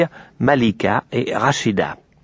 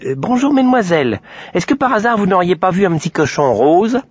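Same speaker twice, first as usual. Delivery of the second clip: put-on a sweet little girl asking politely; 8 semitones higher than usual. A narrator's reading. Aurore politely asks the shopgirls about the pig, high voiced.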